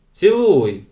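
sharp loud order; commanding. A narrator's reading. Kids ordering Anna to kiss, loud command. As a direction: bark the command at her. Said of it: "звеліли в один голос".

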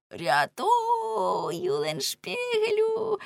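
high, drawn-out wail without force; wailed cry for help. A narrator's reading. Lamme calling his friend to rescue him, thin wail. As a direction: stretch the name into a plaintive high call. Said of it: "заволав Ламе".

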